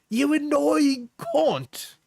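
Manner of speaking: very high, drawn-out mock Cockney; attempted Cockney. Streamer acting out a partner's insults, mock Cockney. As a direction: stretch it out in a hammed-up, high Cockney. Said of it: "It's Cockney, I think".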